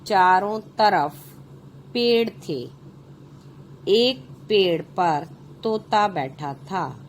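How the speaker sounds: put-on slow, clear reading for children; story narration. A narrator's reading. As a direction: read each phrase out slowly to the class. Reads the story aloud. (बच्चों के लिए कहानी पढ़ना)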